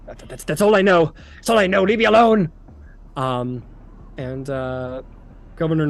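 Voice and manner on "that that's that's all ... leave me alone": higher, louder, desperate begging; frightened pleading. Acted. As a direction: plead to be left alone, cornered and scared. Frightened cultist begs to be left.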